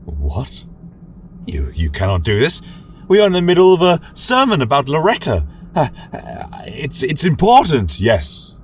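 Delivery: begging not to be stopped; panicked protest. Acted. Reader protests being silenced.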